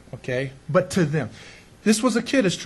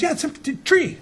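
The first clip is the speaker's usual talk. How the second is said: put-on Belizean accent; 9 semitones higher than usual. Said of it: "Belizeans can't pronounce the th sound".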